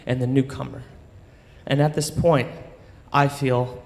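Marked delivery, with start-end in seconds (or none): none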